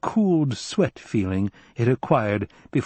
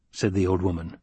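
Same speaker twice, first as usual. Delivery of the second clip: usual speaking voice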